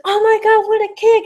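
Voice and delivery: high, excited wrestling call; enthusiastic commentary. Shown as a demonstration. Showing a coworker how to commentate on a kick, enthusiastic. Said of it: "The only problem that she could work on is her enthusiasm".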